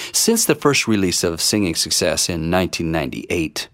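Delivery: ordinary delivery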